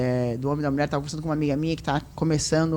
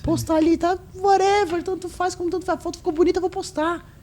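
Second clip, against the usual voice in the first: high-pitched, carefree whatever voice; high put-on voice, shrugging it off; acted breezy indifference; 12 semitones higher, 4 dB louder than usual. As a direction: play it airy and unbothered, way up high. Acting out her carefree posting attitude.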